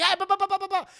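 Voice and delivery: high, loud imitated babble; rapid mock shouting of nonsense; loud blabbering. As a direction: burst in jabbering over everyone. Acting someone barging into an office.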